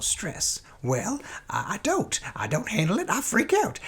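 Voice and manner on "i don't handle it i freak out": normal talking voice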